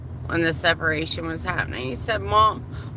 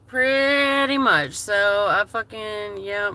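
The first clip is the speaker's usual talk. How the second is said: usual speaking voice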